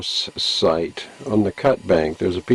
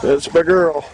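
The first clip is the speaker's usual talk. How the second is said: proud cheer; 6 semitones higher than usual. Real, not acted. Father cheering daughter.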